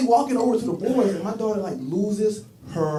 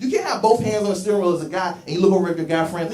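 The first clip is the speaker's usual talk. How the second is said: normal talking voice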